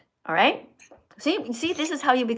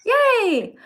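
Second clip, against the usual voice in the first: excited cheer; 11 semitones higher, 7 dB louder than usual. Real, not acted. Praising student's right answer.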